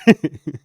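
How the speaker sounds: a sudden loud real laugh; burst of laughter. Real, not acted. Host laughing at his own voice-message joke, loud real laugh.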